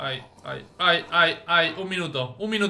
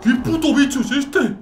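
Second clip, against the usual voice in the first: loud, high shocked outburst; alarmed cry at the strange beast; shocked yell; 8 semitones higher, 10 dB louder than usual. Real, not acted. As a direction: shout it at the creature in alarm. Startled by strange creature.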